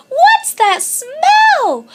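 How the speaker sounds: high, loud disgusted cub; high baby bear grossed out; disgusted gag. A narrator's reading. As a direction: recoil from the stink.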